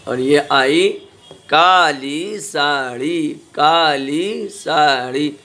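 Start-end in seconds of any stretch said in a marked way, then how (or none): 1.5-5.3 slow, drawn-out words for the class; slow reading drill. Shown as a demonstration. Teacher slowly reading practice words to children. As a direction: read each phrase slowly for pupils to copy. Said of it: "अब ये मिला के पढ़ा".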